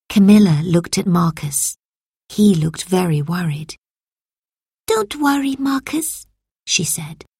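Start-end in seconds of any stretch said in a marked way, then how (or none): 4.9-6.3 put-on gentle girl voice. A narrator's reading. Camilla comforting.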